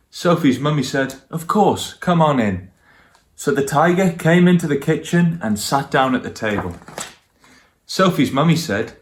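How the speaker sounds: lively storybook narration for little listeners; reading to children. A narrator's reading. Grown-up reading a picture book aloud to children, lively storytelling. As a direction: tell it warmly like a bedtime book.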